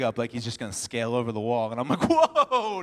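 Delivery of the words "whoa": higher, louder startled whoa; sudden exaggerated dad alarm; mock alarm. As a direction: stop a climbing toddler, playfully alarmed. Dad startled by climbing toddler.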